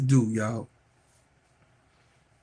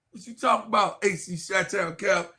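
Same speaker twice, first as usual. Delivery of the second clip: ordinary delivery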